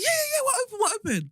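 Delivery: very high, excited gabble; imitated eagerness. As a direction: mimic someone falling over himself to help. Retold eager pizza man.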